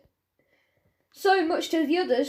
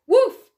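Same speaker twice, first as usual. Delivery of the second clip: loud sharp woof; dog bark; 10 semitones higher, 10 dB louder than usual. A narrator's reading. Dog barking.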